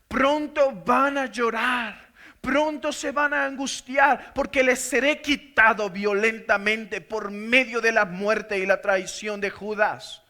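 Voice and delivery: loud, slow preached warning; impassioned preacher's warning; fervent preaching. Acted. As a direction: warn the disciples with fire. Preaching Jesus' words to disciples.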